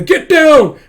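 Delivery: very loud, high-pitched yell; shouted warning. Acted. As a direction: yell a warning before the blast. Warning as shell comes.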